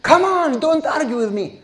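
hammed-up bossy elder; scolding elder. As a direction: brush him off impatiently.

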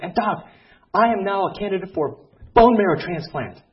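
imitating a thrilled patient, high and loud; excited patient. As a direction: gush out the news, loud and high-pitched. Patient announces he is transplant candidate.

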